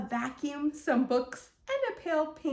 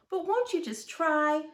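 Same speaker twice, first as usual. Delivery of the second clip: confused; 5 semitones higher than usual. A narrator's reading. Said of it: "Bear sounded confused".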